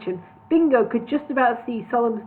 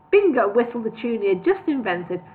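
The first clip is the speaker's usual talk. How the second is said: usual speaking voice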